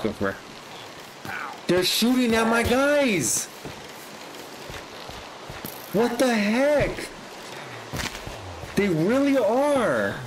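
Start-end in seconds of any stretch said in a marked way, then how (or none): 1.7-10.1 high, slow, outraged shout; real indignant cry, pitched way up; outraged yell. Real, not acted. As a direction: yell it in disbelief as your villagers get shot. Villagers attacked by raid, outraged.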